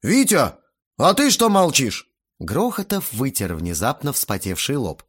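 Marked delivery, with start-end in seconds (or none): 0.0-2.1 loud, high appeal; indignant demand. A narrator's reading. Sweating guest prods the silent father, indignant. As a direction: press him loudly for support.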